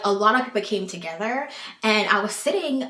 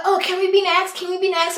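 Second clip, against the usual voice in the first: higher, louder imitation of pushy onlookers; mocking eager pesterers; 10 semitones higher, 5 dB louder, faster than usual. Swingers begging to be next, mockingly eager. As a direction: pester them in a mock-excited high voice.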